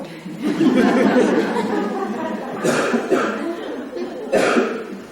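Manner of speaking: long airy laugh at the punchline; long breathy laugh. Real, not acted. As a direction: let a breathless laugh roll out. Laughs after the anecdote.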